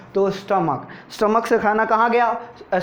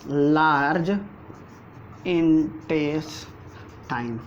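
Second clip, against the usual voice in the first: Large then intestine stretched very slowly; drawn-out key term; 3 semitones lower, 3 dB quieter, much slower than usual. Shown as a demonstration. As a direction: drag out intestine for the class to write. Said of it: "teacher stretches key term". (धीरे-धीरे खींचकर बोलना)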